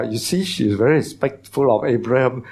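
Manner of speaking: ordinary delivery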